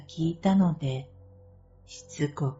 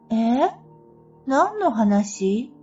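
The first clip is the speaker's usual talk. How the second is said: puzzled; 6 semitones higher, 4 dB louder, slower than usual. Retold or imitated. S confused, doesn't remember.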